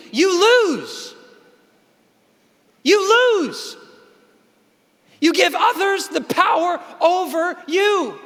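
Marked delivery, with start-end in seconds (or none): 0.1-3.8 emphatic. Real, not acted. Emphatic repeated verdict.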